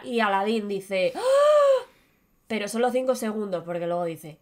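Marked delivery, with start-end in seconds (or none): 1.1-1.8 high short cry of shock; brief mock horror; shocked gasp. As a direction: gasp at the bad news. Aladdin reacts to news.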